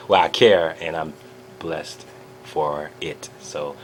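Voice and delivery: ordinary delivery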